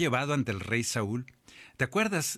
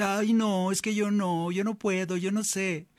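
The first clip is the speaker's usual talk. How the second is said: timid refusal; 9 semitones higher than usual. Acted. Young David refusing the king's call, a high fearful whine. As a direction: beg off it like a scared boy.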